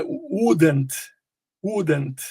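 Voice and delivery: "wouldn't" with a short oo. Shown as a demonstration. Teacher modelling the negative, "wouldn't" with short oo. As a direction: say "wouldn't" with a brief oo in the first syllable. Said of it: "esse uzinho curto".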